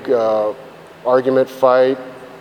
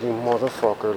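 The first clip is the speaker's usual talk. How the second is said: imitating the wife's quiet, stunned curse; hushed insult; 8 dB quieter than usual. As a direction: quietly mutter it in stunned disbelief.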